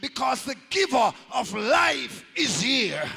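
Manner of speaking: high, loud, ringing preacher's shout; climactic preaching. Acted. Preacher lifting the grieving mothers, shouting in triumph.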